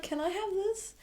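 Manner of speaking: swooning fan pleading in a squeaky high voice; squealing want. Pretend. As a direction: beg for him like a kid at a toy store. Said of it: "And I'm just like".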